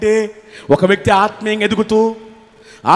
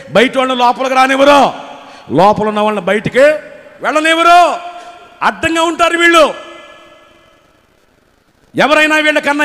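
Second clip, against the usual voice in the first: higher, louder barrage of rhetorical questions; fiery preaching; 7 semitones higher, 8 dB louder than usual. Acted. Preacher challenging the congregation, loud and urgent. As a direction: pound each question at the church.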